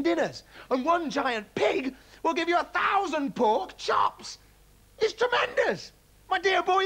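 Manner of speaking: very high, loud raving; dad raving about giant animals; manic excitement. A narrator's reading. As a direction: build to a frenzy.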